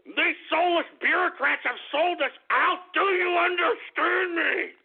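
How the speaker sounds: worked-up, pleading anger, climbing in pitch; overwrought, slowing on the final question; too emotional. Real, not acted. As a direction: demand to be understood. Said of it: "I get a little too emotional".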